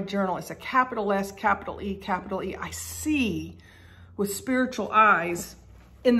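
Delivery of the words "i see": "see" drawn out for emphasis; stress on see. Real, not acted. Preacher describing spiritual sight, stressing "see". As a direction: hold the word "see" to mark it. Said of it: "an emphasis on the word see".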